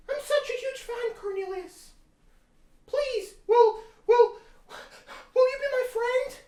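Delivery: put-on very high, giggly, gushing girl voice; Jane. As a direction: squeal it out like an excited fan. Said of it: "Jane came along one day".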